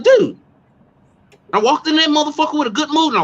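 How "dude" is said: high, loud stunned cry of dude. Retold or imitated. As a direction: blurt it out in shock. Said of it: "I'm like".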